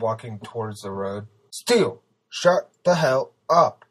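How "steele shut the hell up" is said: high, loud, drawn-out order; forceful shouted command; shouting back. A narrator's reading. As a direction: bellow each word slowly. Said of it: "the man shouted back".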